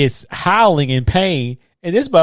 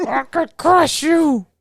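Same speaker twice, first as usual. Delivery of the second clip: mock villain threat; 13 semitones higher, much slower than usual. Echoing villain line.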